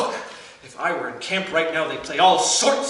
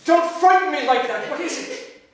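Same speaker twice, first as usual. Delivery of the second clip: higher, jumpy protest; startled, begging her to stop; a fugitive startled by a sudden move; startled plea; 8 semitones higher than usual. Acted.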